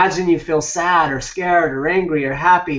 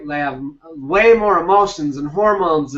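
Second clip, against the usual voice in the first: ordinary talk, nothing marked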